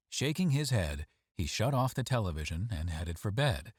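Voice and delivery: plain reading voice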